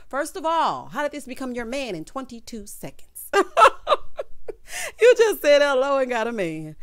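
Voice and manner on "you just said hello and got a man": high shrieking laugh into the words; squealing with laughter; laughing hard. Real, not acted. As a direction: crack up while talking. Cracking up at Amal.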